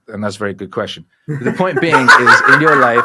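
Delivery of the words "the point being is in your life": talking through a laugh. Real, not acted. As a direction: laugh while getting back on track. Laughing after the compliment.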